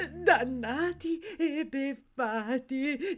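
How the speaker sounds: slow, tearful moan; mournful lament. Acted. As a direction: drag it out in subdued despair. Lamenting, first take. (lamento lento e piagnucoloso)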